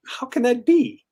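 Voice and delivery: high-pitched incredulous question; retold disbelief. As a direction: re-enact being baffled, pitch leaping up. Reacts to surprising claim about [name].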